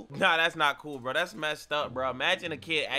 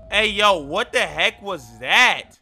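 startled; 9 dB louder, slower than usual. Real, not acted. Startled by something in video.

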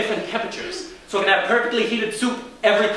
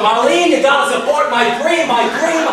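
higher, louder plea to his wife; mock pleading shout; 6 semitones higher, 5 dB louder than usual. Husband pleading with wife Marlene, loud mock plea. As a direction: beg her to back the dream, loud.

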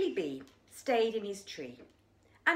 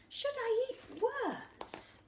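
high wondering voice; much slower than usual. Acted. Character asks, high and slow.

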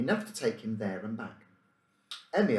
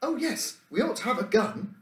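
high, eager, a bit louder chorus; eager echo; 13 semitones higher, 3 dB louder than usual. Acted. Boys echoing the call for a gun, eager and high. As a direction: chime in keenly, pitched up. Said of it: "echoed one or two others".

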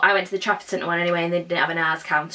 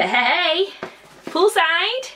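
party cheer; 9 semitones higher, much slower than usual. Pretend. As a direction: hype up a poolside party. Imagining pool party with speaker.

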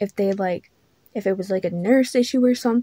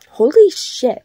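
surprised exclamation; 6 semitones higher, 7 dB louder, slower than usual. Real, not acted. Startled by own yawning.

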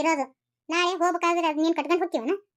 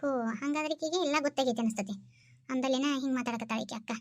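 a lower, softer aside to herself; thinking aloud, half to herself; 5 semitones lower, 6 dB quieter than usual. Acted. Realising the elder sister knows everything, a soft aside. Aside during family quarrel.

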